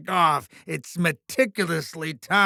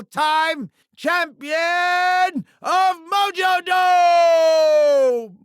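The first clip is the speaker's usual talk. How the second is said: higher-pitched, building to a drawn-out shout; announcer's hype; 6 semitones higher than usual. Acted. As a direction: crown the champion in a ringing arena bellow. Hyping the title like an announcer.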